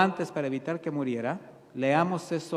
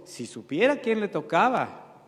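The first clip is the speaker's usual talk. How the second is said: slow, scornful muttering of the Pharisee, slightly louder; mock Pharisee; 4 semitones higher, 5 dB louder than usual. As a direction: sneer it as the self-righteous Pharisee. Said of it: "que dijo". (fariseo despectivo, lento)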